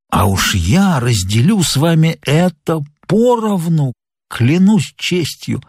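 lightly voiced captain, slow and drawn out; a hint of a weakened old sailor, earnest and unhurried; ailing sea captain, confiding. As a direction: confide a warning slowly, word by weighty word. Dying captain's role in story narration.

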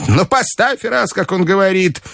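put-on very high old-lady voice; commanding grandmother. A narrator's reading. Grandmother ordering her companion to bet, high and commanding. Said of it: "приказала мне бабушка".

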